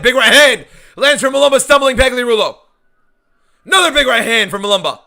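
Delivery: loud, high play-by-play call; excited commentary. Real, not acted. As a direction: shout the big punch as it lands.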